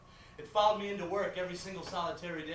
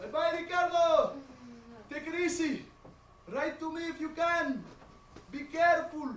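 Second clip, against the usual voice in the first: calling out goodbye; 6 semitones higher than usual. Acted. Mother waving off her son to jail, calling out. Mother calls farewell across courtroom.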